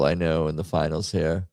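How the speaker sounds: ordinary talk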